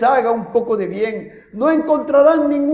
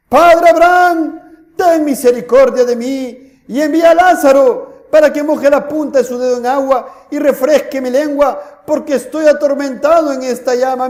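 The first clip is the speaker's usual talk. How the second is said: crying out loud; 4 dB louder than usual. Acted. Rich man in torment begs Abraham for mercy, crying out. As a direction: cry out for mercy at full voice. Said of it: "Entonces él dando voces dijo".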